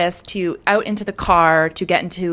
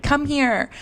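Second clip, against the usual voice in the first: mock coaxing call, higher voice; calling a dog; 8 semitones higher than usual. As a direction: summon him like a pet, pitched up. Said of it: "demanding him like a dog".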